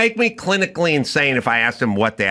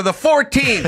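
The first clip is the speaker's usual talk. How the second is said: high mock answer; 10 semitones higher than usual. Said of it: "And he'll go".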